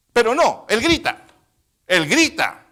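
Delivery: loud, emphatic, high proclamation; full-force preaching. Real, not acted. Preacher insisting to the congregation that he cries out, forceful shouts. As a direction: drive each short phrase home loudly.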